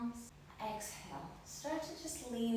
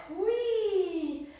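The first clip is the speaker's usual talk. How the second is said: high, loud, drawn-out whee; a gleeful squeal of a ride; playful whee; 9 semitones higher, 7 dB louder than usual. Real, not acted. Yoga teacher swinging up into downward dog, playful whee.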